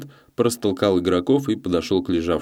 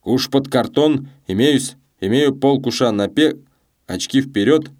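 higher, drawn-out gambler's calls; sonorous voice; 6 semitones higher, 4 dB louder than usual. A narrator's reading. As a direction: call your bets with a ringing voice. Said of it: "его звучный голос".

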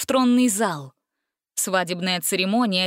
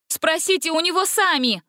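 high, eager challenge; high bold suggestion; challenging offer; 8 semitones higher than usual. A narrator's reading. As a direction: dare them to ask. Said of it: "предложила Гретель".

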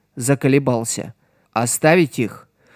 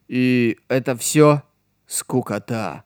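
scoffing; 2 semitones lower, 3 dB quieter, slower than usual. A narrator's reading. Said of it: "Ома только хмыкнул".

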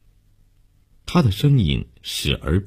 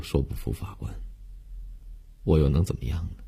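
soft, hollow and subdued; numb resignation; 8 dB quieter than usual. A narrator's reading. Condemned girl admitting she is helpless, numb and quiet. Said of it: "悠悠木然的神态".

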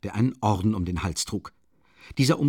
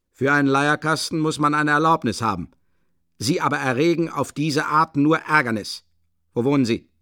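raised, official reprimand; stern policeman; 7 semitones higher, 6 dB louder than usual. A narrator's reading. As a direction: lay down the law as a policeman. (strenger Schutzmann, etwas lauter und höher)

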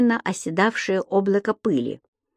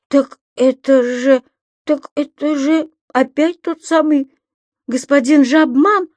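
exclaiming; 6 semitones higher, 4 dB louder than usual. A narrator's reading. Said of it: "воскликнул Пепперминт".

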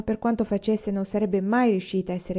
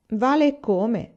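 retorting; 4 semitones higher, 5 dB louder, much slower than usual. A narrator's reading. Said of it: "ribatté Laura".

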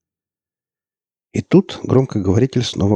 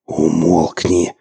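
desperate hiss; 3 dB louder, much slower than usual. A narrator's reading. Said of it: "в отчаянии прошипел Марк".

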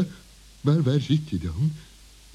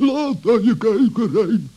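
gleeful gloating laugh; 7 semitones higher than usual. Acted. Mole gloats over tied fox.

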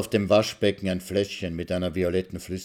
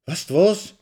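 higher, sharp call; heated shout; 9 semitones higher, 4 dB louder than usual. Acted. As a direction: burst out at her. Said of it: "schreit Maxl hitzig".

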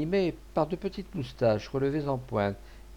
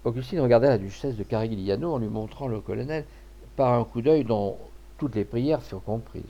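usual speaking voice

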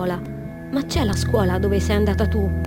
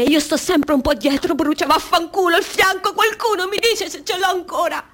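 rising panic; 7 semitones higher than usual. Acted. Burning woman blurting out her fear mid-thought, panicked. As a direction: voice climbing into higher, agitated urgency.

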